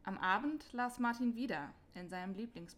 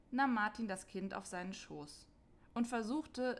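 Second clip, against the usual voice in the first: ordinary delivery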